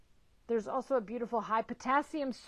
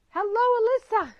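delighted greeting; 12 semitones higher, 13 dB louder than usual. Real, not acted. Spotting an old friend online.

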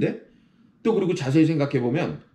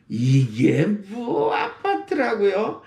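drawn-out 이게 뭐; 7 semitones higher, much slower than usual. Shown as a demonstration. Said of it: "이게 뭐 기법을 써보세요".

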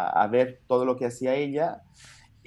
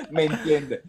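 laughing through it; 3 semitones higher, 3 dB louder than usual. Retold or imitated. Laughing as he mocks his beginner Spanish. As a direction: still laughing, mock question.

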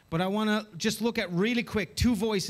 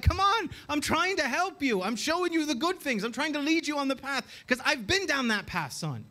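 pleading parent; 7 semitones higher than usual. Acted. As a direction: plead with a wayward child. Acted parent pleading with rebellious child.

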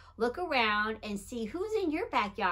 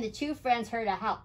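plain reading voice

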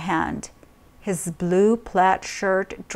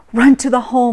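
louder urgent order; demanding; 3 semitones higher, 7 dB louder, much faster than usual. A narrator's reading. Mother ordering her child to hide, urgent demand. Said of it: "Mom demanded".

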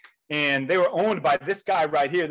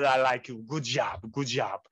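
put-on low, softer Russian-accented praise; low Russian accent, dropping softer; 6 semitones lower, 3 dB quieter than usual. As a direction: imitate the Russian boss's gruff approval. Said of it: "in a thick Russian accent".

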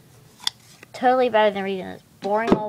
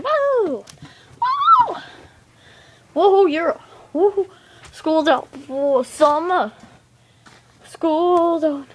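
excited cheering; 4 semitones higher, 4 dB louder, slower than usual. Pretend. Kids cheer school's out.

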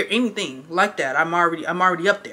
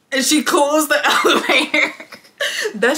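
cracking up mid-sentence, pitched high; words breaking into a laugh; 7 semitones higher than usual. Real, not acted. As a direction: tell it through real laughter. Cracks up telling funny moment.

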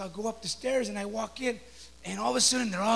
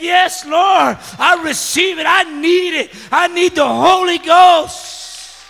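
very loud, high cry to the Lord; fervent prayer shout; 7 semitones higher, 17 dB louder, much slower than usual. Retold or imitated. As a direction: relive a desperate prayer at full voice, stretching the end. Acts out own desperate prayer.

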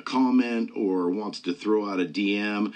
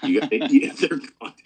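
laughing; 3 semitones higher, 7 dB louder than usual. Real, not acted. Laughs at the guest's reply.

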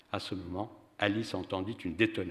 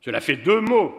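stern and loud, pitched up; severe; 9 semitones higher, 12 dB louder than usual. A narrator's reading. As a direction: scold sharply. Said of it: "dit sévèrement Pierrot".

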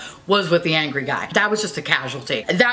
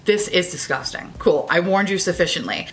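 ordinary delivery

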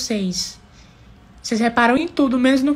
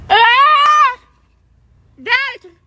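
surprised shriek; 13 semitones higher, 7 dB louder than usual. Acted. Shocked at the man.

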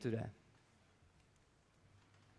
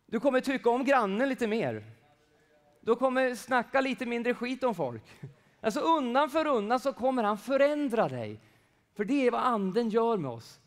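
higher, louder fervent preaching; 7 semitones higher, 7 dB louder than usual. Real, not acted. Preacher promising change to listeners, higher and louder. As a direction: lift it and drive it home.

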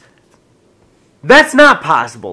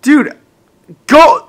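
higher and louder outburst; exasperated yell; 10 semitones higher, 7 dB louder than usual. Real, not acted. Roommate fed up with his friend, yelling at him. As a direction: snap it out, fed up.

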